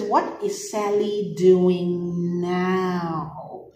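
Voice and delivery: What is Sally doing now said very slowly; slow reading. Shown as a demonstration. Teacher reads the question slowly to class, very slow. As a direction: read it out slowly, word by word. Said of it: "It says".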